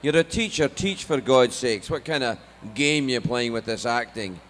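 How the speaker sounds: exasperated Scottish father, imitated; thick Scottish accent. Dad objecting to acting career, Scottish scolding. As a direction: scold your son in broad Scottish. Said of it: "my parents have a very thick Scottish accent".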